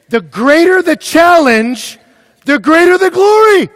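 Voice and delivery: high, drawn-out yell; full-force preaching shout. Real, not acted. Preacher driving home his point to students, shouting. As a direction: thunder the point at full volume.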